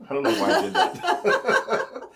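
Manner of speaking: high breathy laughing words. Real, not acted. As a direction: laugh through it, pitch up high. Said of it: "I just went a little weird there, my pitch".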